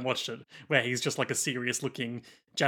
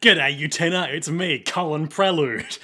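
louder mock Aussie challenger; Australian accent; 4 dB louder than usual. Colin Prelude challenging Utena, mock Australian accent. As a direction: greet her like an ocker duelist.

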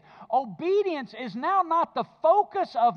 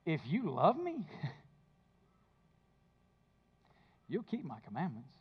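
softer, tender, with a small laugh between the words; gentle invitation; 8 semitones lower, 10 dB quieter than usual. Shown as a demonstration. Pastor giving the true reading of the verse, soft and tender. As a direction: offer it as a loving promise, not a rule. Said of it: "Here's what the verse really says".

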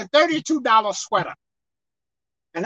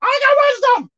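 much higher, louder indignant insistence; emphatic protest; 10 semitones higher, 3 dB louder than usual. Retold or imitated. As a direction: push back hard, indignant. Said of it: "I'm like".

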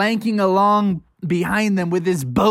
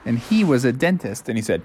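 ordinary delivery

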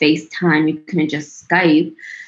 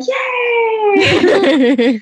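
excited cheer. Retold or imitated. Kid cheering for Saturday donuts, high excited yay. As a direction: cheer like a kid hearing donuts are coming. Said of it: "Yes, we're like".

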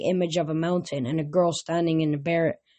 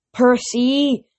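loud, higher pleading shout; pleading call; 6 semitones higher, 6 dB louder than usual. A narrator's reading. Chiron begging hero to stop, pleading call. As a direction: beg him to stop. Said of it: "Chiron pleaded".

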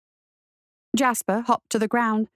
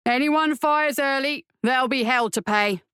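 commanding shout; 2 semitones higher than usual. A narrator's reading. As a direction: call out a stern order. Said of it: "the master shouted".

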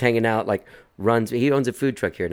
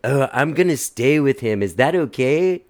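drawn-out, higher stoner imitation; stoned-sounding friend; 3 semitones higher than usual. Friend asks to stay with the patient, imitated stoned drawl. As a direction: imitate a spaced-out friend, stretching the words. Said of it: "like, super stoned".

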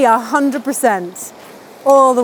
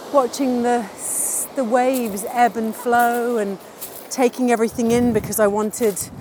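ordinary talk

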